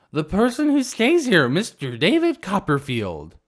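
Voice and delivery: high, loud tipsy voice; drunk girl. Acted. Drunk girl naming the landlord, high and loud. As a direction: play it drunk and sure of herself.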